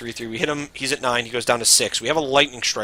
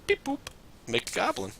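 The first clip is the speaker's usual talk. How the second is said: toy robot voice; 9 semitones higher, 5 dB quieter than usual. Pretend. Beep boop before robot line.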